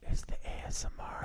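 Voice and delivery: hushed ASMR whisper. Real, not acted. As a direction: whisper it close to the mic. ASMR croissant tasting whisper.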